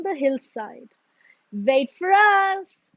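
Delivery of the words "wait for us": higher, louder, slow call; calling after. A narrator's reading. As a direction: shout after a friend running off. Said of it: "cried Lippity Rabbit and Very Small Frog".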